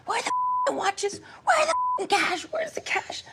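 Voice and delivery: high mock shout, whispered at the end; hammed-up demand for cash; imitating robber. Retelling the robber's demand in a high mock shout. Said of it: "one of them that was collecting".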